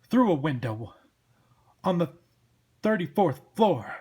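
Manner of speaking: loud, incredulous echo in a cop voice; blustering detective boss; exasperated cop Sam. Acted. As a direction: repeat it loudly in disbelief.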